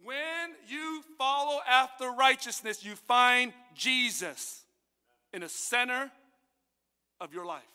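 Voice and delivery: very slow, drawn-out preaching; solemn sermon climax; preaching slowly. Acted. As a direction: stretch every word out.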